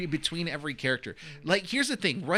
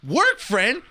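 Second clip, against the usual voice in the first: very high, loud, offended shout; mock outrage; 7 dB louder than usual. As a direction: protest like a scorned partner, hammed up. Acted offended retort from film.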